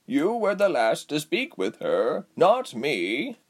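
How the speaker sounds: slow, insistent raspy character voice. A narrator's reading. Giselle deflecting blame to Reshma, slow and insistent. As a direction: press the point in a drawn-out rasp. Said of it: "Giselle insisted".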